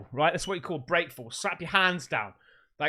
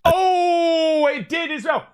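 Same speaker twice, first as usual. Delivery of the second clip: frightened cry; 6 semitones higher, 6 dB louder, slower than usual. Real, not acted. Startled by the eerie voice.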